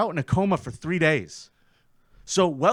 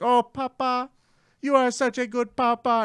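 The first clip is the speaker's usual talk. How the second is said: put-on kid voice; 8 semitones higher than usual. Kids voiced in the story.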